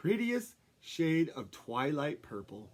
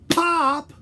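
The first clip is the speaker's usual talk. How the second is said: sharp loud shout; loud pop; 10 semitones higher, 11 dB louder than usual. Shown as a demonstration. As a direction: shout it for the kids to copy. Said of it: "You ready?".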